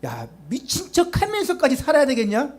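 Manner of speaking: high-pitched scornful challenge; 따지는 사람들. Acted. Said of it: "그럼 어떤 사람들 그러죠". (높은 목소리로 비꼬며 따지듯)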